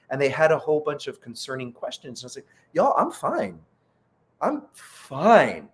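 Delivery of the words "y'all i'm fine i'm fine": high-pitched protest, then punched repeat; retold emphatic brush-off; insistent reassurance. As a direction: wave off their worry, stretching the second fine. Retold reassurance to worried PR team.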